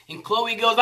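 ordinary talk

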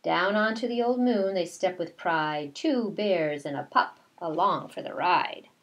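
ordinary talk

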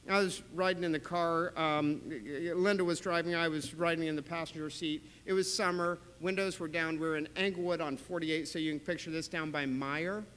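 usual speaking voice

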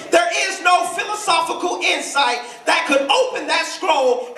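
high, ringing sermon build; impassioned preaching. Real, not acted. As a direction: preach it with rising fire. Preaches at full force.

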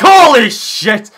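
loud, high, drawn-out shock; astonished yell. Retold or imitated. Friends reacting to the picture shown, astonished yell. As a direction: blurt it out in amazement, stretching both words.

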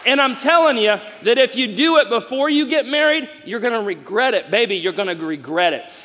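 loud, high, fired-up preacher; full-force preaching. Acted. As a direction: preach it to the room and win an amen.

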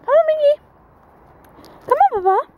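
high sing-song call to a dog. Real, not acted. Calling her dog Mickey along the street, high sing-song.

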